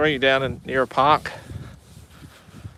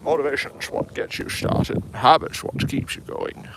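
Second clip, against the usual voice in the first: Sean Connery impression; 2 semitones higher than usual. Shown as a demonstration. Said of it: "that would have been a good Sean Connery".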